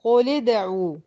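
"قل ادعوا" joined as quli-d'u; careful tajweed example, "قل ادعوا" linked by i; demonstrating the kasra link. Shown as a demonstration. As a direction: put a short i on the lam of "قل ادعوا". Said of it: "يلا حركي بالكسر على طول".

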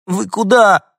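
very high, loud cry on the verge of tears; little dog crying out as his friends leave; near-tearful cry. A narrator's reading. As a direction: shout it almost crying. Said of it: "чуть не плача крикнул Дуля".

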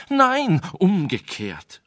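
high, loud cry, the second word slow; frantic cry. Acted. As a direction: protest in panic, stretching the correction. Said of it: "rief der Weise".

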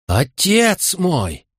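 high, slow drawn-out moan. Acted. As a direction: groan out the grief, drunk. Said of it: "простонал Регал".

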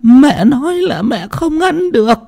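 high, thin character voice; high put-on voice. Son's wife calling high and shrill to the household. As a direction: pitch it way up like another person.

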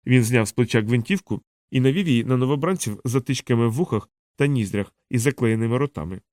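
usual speaking voice

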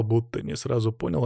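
ordinary delivery

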